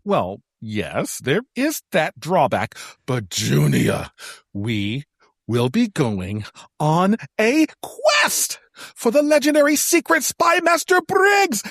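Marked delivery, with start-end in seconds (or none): 3.1-11.6 slow build into a shout of the name; building excitement. Acted. Friend announcing the quest to Junia, building to a shout. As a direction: rise from a drawn-out hush to a triumphant shout.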